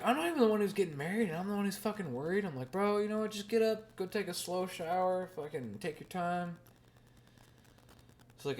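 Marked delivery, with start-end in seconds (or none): 0.0-2.4 fast, high, fretting self-quote; high-pitched mock panic. Groom's friend fretting to himself, high and fast. 2.7-6.6 high voice slowing down at the end; high mock pep talk. Talking himself down before the wedding, high, easing. Self-advice quote, high.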